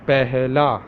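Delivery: Pehla as "peh-la" with a breathy h; Urdu word. Shown as a demonstration. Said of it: "How to say in original Urdu?".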